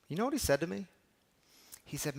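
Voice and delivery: ordinary delivery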